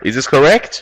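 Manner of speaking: ordinary talk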